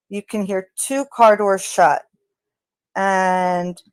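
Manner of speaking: normal talking voice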